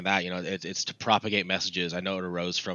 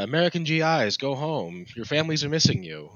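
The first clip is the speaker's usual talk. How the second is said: higher woman's radio propaganda voice; propaganda broadcaster; 7 semitones higher than usual. Retold or imitated. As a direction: coax the troops like a wartime radio host. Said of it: "a woman's voice doing the whole".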